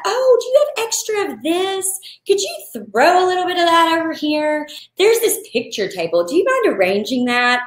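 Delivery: high, sweet pestering voice; imitating guests. Wedding guests pestering the florist, high and sweet. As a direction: ask for extras in a sugary high tone. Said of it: "'cause I get a lot of that".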